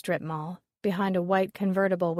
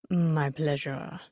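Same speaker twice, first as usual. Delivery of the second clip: put-on slow, lower French-accented drawl; 4 semitones lower, slower than usual. A narrator's reading. Porcelain expert greeting Molly, slow low French drawl. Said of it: "he drawled in a French accent".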